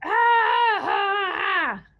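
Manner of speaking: high mock screams, a little cry in them; scared campers shrieking as they flee; playful pretend screams of fleeing campers; screaming campers. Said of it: "ran away screaming".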